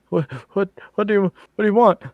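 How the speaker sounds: high, fast, panicked questions; startled and scared, blurting it out; frightened. Acted. Frightened NPC confronted by party.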